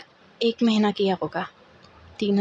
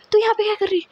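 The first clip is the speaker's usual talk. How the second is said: high-pitched startled question; surprised friend; 10 semitones higher, much faster than usual. Retold or imitated. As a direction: imitate a friend caught off guard. Friends surprised to meet at work. (चौंके हुए दोस्त की नकल, ऊँची आवाज़ में)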